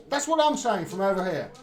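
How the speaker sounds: ordinary delivery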